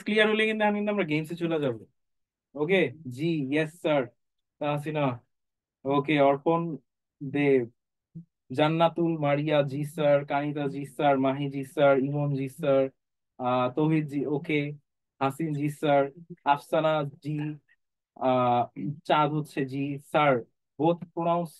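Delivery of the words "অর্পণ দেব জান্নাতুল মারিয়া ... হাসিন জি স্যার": ordinary talk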